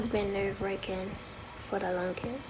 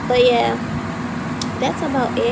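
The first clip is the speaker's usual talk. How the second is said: loud, fast, so the phone hears; talking loud; 8 dB louder than usual. Real, not acted. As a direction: raise the voice over the noise. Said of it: "but I'ma talk loud".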